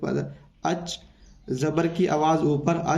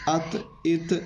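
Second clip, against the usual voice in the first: अत इत उत said slowly and softer, each vowel clear; slow syllable demo; 4 dB quieter, slower than usual. Shown as a demonstration. Teacher showing pupils how to read it, slow and soft. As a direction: slowly model अत इत उत. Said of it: "ऐसे इसको पढ़ेंगे".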